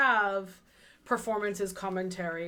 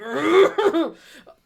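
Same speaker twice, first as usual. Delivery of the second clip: a loud, exasperated burst of laughter; sudden loud laugh, high and bright; 7 semitones higher, 13 dB louder than usual. Real, not acted. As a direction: laugh it off in disbelief. And I'm like.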